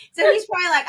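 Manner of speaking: normal talking voice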